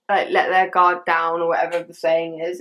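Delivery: normal talking voice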